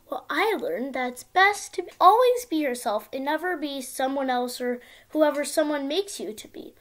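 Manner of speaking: plain reading voice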